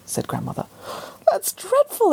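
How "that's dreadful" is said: high, shocked exclamation; exclaiming. Acted. Lucy exclaiming in shock at the pork. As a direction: squeal "dreadful" up high. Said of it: "exclaimed Lucy".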